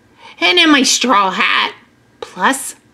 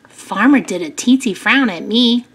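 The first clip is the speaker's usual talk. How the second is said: ordinary delivery, nothing marked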